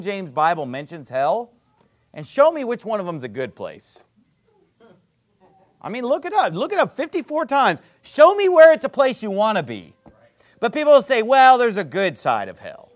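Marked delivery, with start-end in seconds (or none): none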